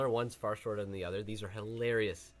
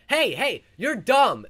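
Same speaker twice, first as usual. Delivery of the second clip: loud, high, mock-aggressive shout; aggressive retold yell; 11 semitones higher, 11 dB louder, much slower than usual. Retelling a stranger yelling on his way to the bus, aggressive. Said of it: "really aggressively".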